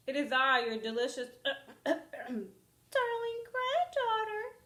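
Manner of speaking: put-on wolf faking a high girlish voice. Wolf pretends to be the granddaughter at the door, high. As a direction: fake a high sweet voice, badly. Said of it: "in a high voice".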